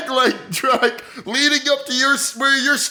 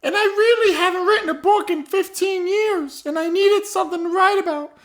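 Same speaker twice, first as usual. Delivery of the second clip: put-on higher, rambling old woman owning up with a laugh; old lady confessing; 5 semitones higher than usual. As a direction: confess as the doddering grandma, pitched up. Grandma character confesses to murder.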